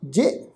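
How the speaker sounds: "ਜੇ" with a light, short vowel; touch the vowel of "ਜੇ" lightly; soft, light tone. Shown as a demonstration. Teacher showing the short sihari reading, "ਜੇ" soft and light. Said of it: "ਪੋਲੇ ਜਿਹਾ ਹਲਕੀ ਜੀ tone ਵਿੱਚ".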